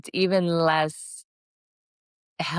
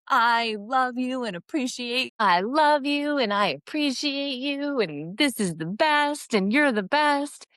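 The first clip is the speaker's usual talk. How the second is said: gushing daughter; 7 semitones higher than usual. Retold or imitated. Imagined daughter gushing thanks.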